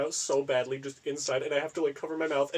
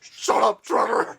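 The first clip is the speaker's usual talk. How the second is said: louder, higher vicious yell; vicious shout; 8 semitones higher, 8 dB louder, slower than usual. Retold or imitated. Retelling the sketch, a vicious shout at Trevor. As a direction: hurl it at him viciously. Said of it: "He just viciously goes".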